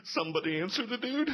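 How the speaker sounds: mock panic. Nervous kid pleading during the sermon.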